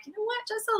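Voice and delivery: talking herself into calm, lifted and soft; self pep talk. Retold or imitated. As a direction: coax yourself out of frustration. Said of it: "but I'm like".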